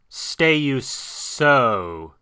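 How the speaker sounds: very slow, hissing serpent voice; hissing snake. Acted. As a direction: draw it out like a hissing python. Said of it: "Kaa hissed".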